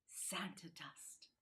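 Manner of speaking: soft, slow, hushed; whisper. A narrator's reading. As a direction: breathe it like a secret. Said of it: "he whispered".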